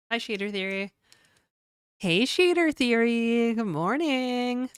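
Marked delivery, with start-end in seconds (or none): 2.0-4.7 cutesy greeting voice. Pretend. Greeting chat in put-on voice.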